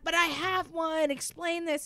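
high, challenging demand; exasperated demand. Retold or imitated. To the doctor denying regrowth: a high exasperated challenge. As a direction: throw it at him as a challenge.

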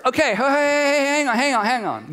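high, insistent interruption; mock protest. Adam halts God to argue, mock protest.

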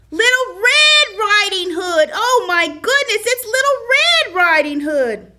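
oh my goodness, correcting him again; exasperated exclaim. Real, not acted. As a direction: throw up your hands and exclaim it.

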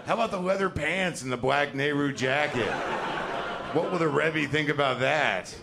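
high, loud fashion pondering; exaggerated delight in a daring choice; mock outfit deliberation. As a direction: pitch it up, hammed-up and showy. Mimicking someone picking a daring outfit.

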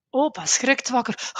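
usual speaking voice

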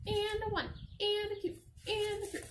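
high band-leader count-in; high playful count-off; sing-song count. Acted.

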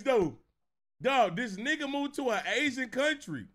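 ordinary delivery

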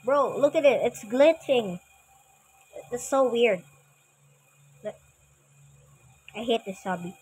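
usual speaking voice

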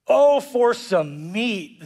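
very slow, longing whine; mock exclamation. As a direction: stretch it out like a starving grumbler. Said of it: "they exclaimed".

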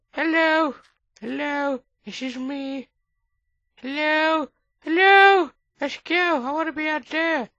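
high, loud cartoonish calls; silly high voice yelling to be let out; squeaky character voice. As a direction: play a trapped little figure begging to go out. High voice calling from inside.

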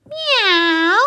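high squeaky meow. Acted. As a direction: mew like a kitten. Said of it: "sang the cats on the wall".

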